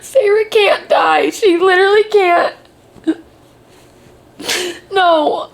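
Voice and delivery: real tearful denial. Real, not acted. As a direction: voice near tears. Distraught over character fate.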